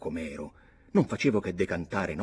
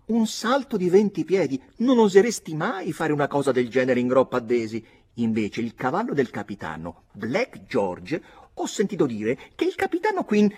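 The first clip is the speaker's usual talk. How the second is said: put-on high, loud, teasing girl's voice; Nora's mocking voice; 6 semitones higher, 7 dB louder than usual. Nora teases Redmond about heroics, in a high coquettish voice. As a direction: play the coy girl baiting him.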